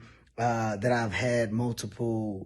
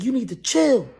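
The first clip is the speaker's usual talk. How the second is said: higher and louder, fast lecture; scolding snap; 7 semitones higher, 5 dB louder, faster than usual. Retold or imitated. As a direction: tell brothers off. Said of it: "Harry snapping".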